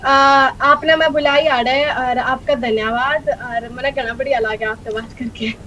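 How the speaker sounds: fast Haryanvi dialect. Shown as a demonstration. Guest demonstrating dialects for the host, rapid Haryanvi. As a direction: give quick thanks in Haryanvi. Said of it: "Haryanvi".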